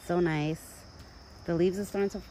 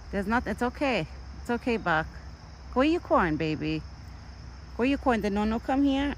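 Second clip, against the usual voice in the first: soothing pet talk; 6 semitones higher than usual. Real, not acted. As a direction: coax the frightened deer back to his food. Calming scared deer again.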